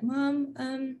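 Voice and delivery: put-on high-pitched child addressing a parent; imitated son. Son noticing the parent's sighs, imitated in a high voice. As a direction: voice a kid tentatively getting Mom's attention. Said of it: "he'll be like".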